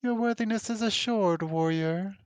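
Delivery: high, grand creature voice; lofty, high creature voice; Quattro voice. Acted.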